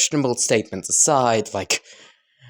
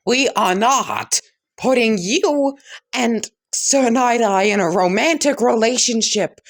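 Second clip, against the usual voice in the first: high-pitched, exasperated telling-off; indignant scolding; 10 semitones higher than usual. Acted. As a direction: lay down the refusal in a high voice. Character scolds and refuses All Might.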